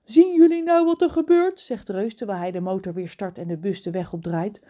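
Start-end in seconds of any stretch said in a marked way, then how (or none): 0.0-1.6 high, loud reproach; reproachful. Acted. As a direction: scold them gently. Reus verwijt John en Kraai.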